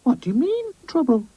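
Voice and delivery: put-on very high boy character voice; Boz, cheeky boy. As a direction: query it in a high child's voice.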